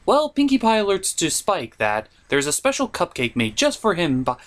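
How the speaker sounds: normal talking voice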